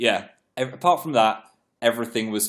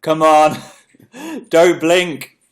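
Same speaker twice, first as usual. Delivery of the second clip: self-urging; 6 semitones higher, 8 dB louder, slower than usual. Retold or imitated. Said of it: "I was like".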